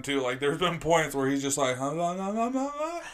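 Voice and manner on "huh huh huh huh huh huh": imitating a friend's mumbled huh-huhs, rising; mock mumble, climbing up high; mumbling. Mocking how Al mumbles on the mic. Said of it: "Al's bad at the mumbling".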